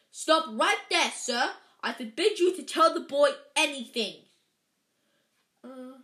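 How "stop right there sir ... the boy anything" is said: loud, high, forceful order; commanding. A narrator's reading. Uncle forbidding the giant to speak, loud command. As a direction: lay down the law at full volume. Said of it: "he commanded".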